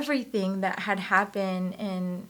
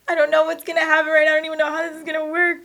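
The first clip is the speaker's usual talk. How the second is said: fast and loud, higher; flustered rush; 7 semitones higher, 8 dB louder, much faster than usual. Retold or imitated. Woman flustered by God's plan, fast and loud. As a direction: tumble it out in a panic.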